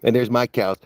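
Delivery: ordinary talk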